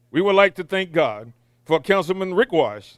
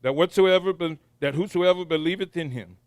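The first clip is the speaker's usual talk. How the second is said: prayer; 4 semitones lower, 3 dB quieter than usual. Acted. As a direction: pray quietly with reverence. Pastor prays the invocation.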